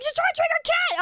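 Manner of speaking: high-pitched mock accusation from viewers; imagined accusing viewers. People reacting to the cat video, high mock accusation. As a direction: pitch it way up as an outraged onlooker. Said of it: "people are gonna be like".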